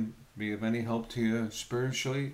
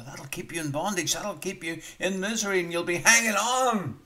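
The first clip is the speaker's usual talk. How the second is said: rising preaching build; 7 semitones higher, 5 dB louder than usual. Real, not acted.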